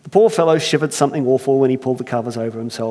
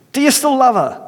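higher, louder Irish disbelief; incredulous Irish; 11 semitones higher, 5 dB louder than usual. Acted. Earthmaker astonished, Irish accent. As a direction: repeat it in astonishment. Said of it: "I can't help but try it".

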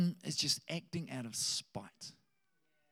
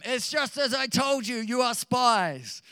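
raised, higher voice, slowing on the charge; stern accusation; 6 semitones higher, 6 dB louder than usual. Acted. Joseph accusing his brothers of spying, stern and raised.

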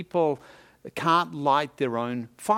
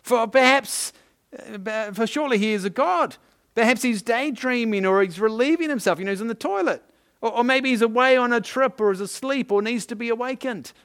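high-pitched, sarcastic trash talk; mocking; 8 semitones higher, 4 dB louder than usual. Elijah taunting rivals about their absent god, mocking. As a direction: sledge the other team with fake helpful suggestions. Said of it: "Elijah began mocking them".

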